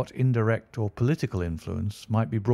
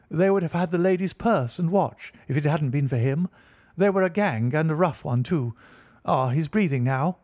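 excited woman; 6 semitones higher than usual. A narrator's reading. The woman tells the crowd about the gang, excited. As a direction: tell everyone what happened.

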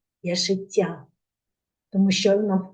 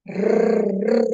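long rolled r like an engine; rolled rocket rrr. Shown as a demonstration. Articulation game for children: rolled rocket r. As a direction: roll the r like a rocket warming up. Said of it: "Як ракета збирається в космос".

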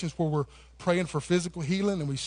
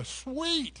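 high, airy stoner "Sweet"; surfer sweet; 8 semitones higher, 6 dB quieter than usual. Retold or imitated. Pastor mimics the Bill and Ted movie slackers. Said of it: "they go".

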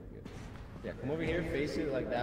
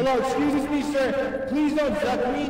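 silly pleading voice; 8 semitones higher, 3 dB louder than usual. Pretend. Goofy pleading voice.

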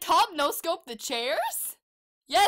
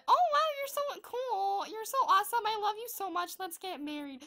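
swooning fangirl; 6 semitones higher, 5 dB quieter than usual. Pretend.

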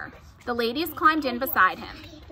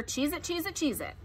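usual speaking voice

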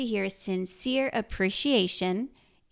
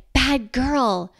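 loud, sharp surprised rebuke, a bit higher; startled scold; 4 semitones higher, 8 dB louder than usual. Retold or imitated. Mother catching daughter cutting sister's hair, loud startled scold. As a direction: blurt it out in shock at the child. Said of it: "And in your surprise, you say".

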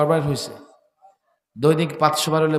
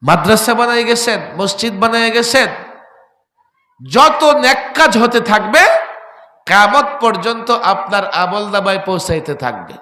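high, loud fervent preaching; full-force preaching; 8 semitones higher, 11 dB louder than usual. Real, not acted. Preacher stirring the gathering, full-force fervent preaching. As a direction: preach it out to the whole crowd.

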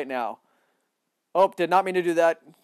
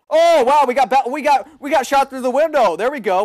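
high and loud; surprised; 7 semitones higher, 5 dB louder than usual. Real, not acted. Gamer shot through a window, high loud surprise. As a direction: cry it out in shock.

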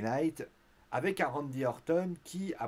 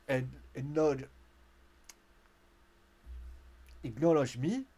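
bad English accent; slower than usual. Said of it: "mon accent est merdique".

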